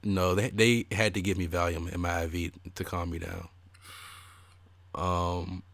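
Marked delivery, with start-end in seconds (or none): none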